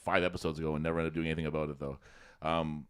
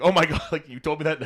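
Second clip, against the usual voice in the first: higher, louder exasperated husband through a laugh; mock exasperated; 8 semitones higher, 7 dB louder than usual. Husband blaming his wife for bad directions, mock exasperated. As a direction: throw up your hands, half-laughing. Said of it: "He's like".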